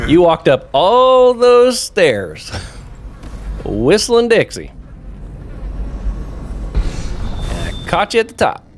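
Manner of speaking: high, slow teasing sing-song; mocking sing-song taunt. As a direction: gloat over him like a playground bully. Taunting a knocked-out guard.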